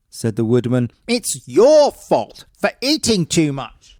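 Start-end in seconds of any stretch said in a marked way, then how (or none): 1.1-4.0 high, louder, slow accusing husband; husband blames wife; blaming. Acted. Woodman blames his wife for the empty pot, high and loud.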